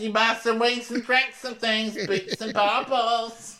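shrill-pitched street hawker call; street barker. Retold or imitated. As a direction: hawk the restaurant to passersby. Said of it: "in the street going".

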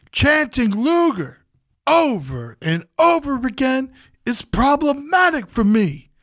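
hammed-up MC sermon to the audience; mock solemn hype speech. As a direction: preach it to the crowd like a rally speaker. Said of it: "full pep rally".

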